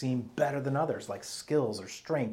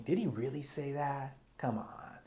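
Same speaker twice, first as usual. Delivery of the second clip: softer, sceptical serpent left hanging; understated emphatic disbelief, trailing away; insinuating doubt; 2 semitones higher, 6 dB quieter than usual. Retold or imitated. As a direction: imitate a doubter's quiet, leading nudge. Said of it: "saying it with emphasis, emphatically. He kind of leaves it hanging".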